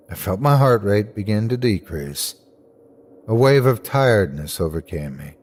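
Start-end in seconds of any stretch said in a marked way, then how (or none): none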